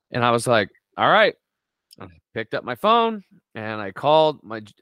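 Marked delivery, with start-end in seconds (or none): 1.0-1.3 fired-up agreement. Retold or imitated. Answering brother's voice, resolved.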